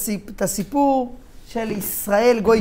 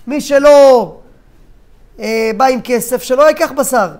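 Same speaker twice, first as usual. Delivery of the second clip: loud, raised butcher's yell; shouting; 3 semitones higher, 7 dB louder, slower than usual. Acted. Butcher warning customers without money, shouting. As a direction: call it out over a noisy market. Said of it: "הרב שומע מאחוריו עוד פעם צעקות".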